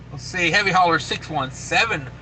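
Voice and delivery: ordinary talk, nothing marked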